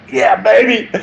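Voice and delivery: high, hammed-up "Yeah, baby"; Austin Powers style "yeah baby". Teacher blurts a playboy catchphrase at class, hammed-up and high. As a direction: blurt it like a swinging sixties playboy. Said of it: "I'm sorry, I'm not gonna say that".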